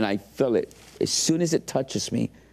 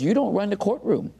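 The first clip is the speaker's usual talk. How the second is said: higher-pitched mock indignation; retold anger; 9 semitones higher than usual. Lawyer scolding an inmate over the courtroom, retold anger. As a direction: imitate an indignant lawyer putting him down. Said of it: "And he got mad".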